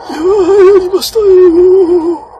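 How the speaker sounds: high, slow, put-on celebratory voice; failed impression. Gamer attempting a celebrity voice after his win. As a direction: try to mimic someone and miss. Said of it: "モノマネして出そうとしたら全然似てない".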